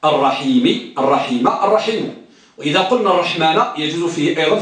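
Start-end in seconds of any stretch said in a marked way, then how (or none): 0.0-2.2 الرحيم said with -i, then bare, then -u; الرحيم spelled out in its three endings; three case endings. Shown as a demonstration. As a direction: give الرحيم each ending in turn: araħimi, araħim, araħimu. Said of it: "ثلاثة أوجه".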